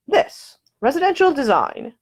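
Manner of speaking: mock grand presentation. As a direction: present it like a proud designer. Nick shows off the room.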